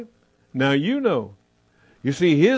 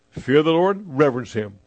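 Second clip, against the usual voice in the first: ordinary delivery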